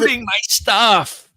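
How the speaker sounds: high, loud in-character complaint; shouted protest. Acted. Mech pilot protesting the shooting, shouted protest. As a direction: yell it over the gunfire.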